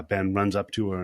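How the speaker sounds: ordinary delivery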